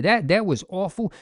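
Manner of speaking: usual speaking voice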